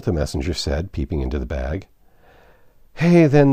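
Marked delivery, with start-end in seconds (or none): none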